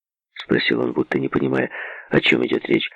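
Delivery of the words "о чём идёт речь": ordinary delivery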